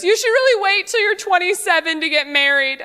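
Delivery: high-pitched heckling woman; imitated audience heckler. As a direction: call out unwanted advice. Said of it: "yell at me from the audience".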